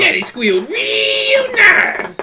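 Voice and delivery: high, slow creepy drawl; creepy villain voice. Acted. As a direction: leer it out slowly, sing-song. Put-on creepy villain line.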